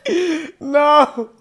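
high, squealing laugh through the words; crying with laughter; helpless laughter. Real, not acted. Cracking up as his friend says church again.

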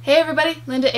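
normal talking voice

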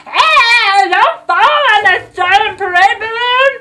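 hammed-up cry of alarm; mock crowd cry. Girl imitating the scared crowd, hammed-up yell. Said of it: "everyone was like".

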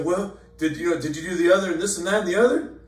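machine-gun the questions impatiently; rattled-off questions. Retold or imitated. Upset brother on the phone rattling off questions, high and fast. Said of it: "he'd rattle off".

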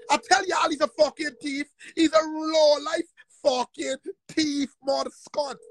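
tearful furious yell; crying shout. Real, not acted. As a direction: rage and sob at once. Furious rant against alleged thief.

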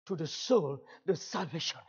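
ordinary talk, nothing marked

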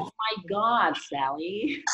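a quick, high-pitched American accent, played for laughs; a hint of American, laughing it off; mock American squeal. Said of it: "give us your best American accent".

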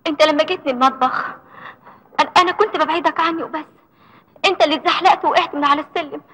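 high, tearful, rising pleas; tearful pleading. Acted. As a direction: plead through tears. Sanaa pleads, crying.

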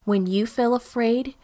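ordinary delivery

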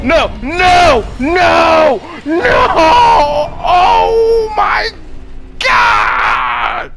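loud, drawn-out cries of no, climbing high; anguished shouting. Real, not acted. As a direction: wail in disbelief at losing. Player losing in the game.

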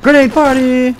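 high, loud whooping announcement; party cheer. Real, not acted. Player throwing grenades at enemies, party cheer. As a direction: yell it out like a party starting.

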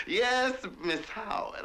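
high, slow, overly polite servant voice; a hammed-up yes-ma'am; mock servile reply. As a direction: play the humble servant sweetly. Servant answering mistress, mock deference.

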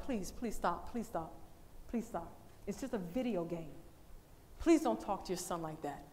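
soft, low, gentle pleading; pleading softly. Retold or imitated. Preacher retelling how she begged the mother, softly pleading. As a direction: beg her quietly to stop.

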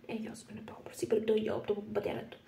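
hushed gossiping whisper. Acted. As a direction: lean in and whisper. Secret aside.

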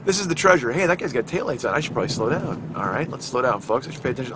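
ordinary delivery

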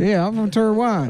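high mock Taiwanese accent; deadpan claim in a fake Taiwanese accent; put-on Taiwanese accent. Candidate explaining himself to moderator, put-on Taiwanese accent. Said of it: "traditional Taiwanese accent".

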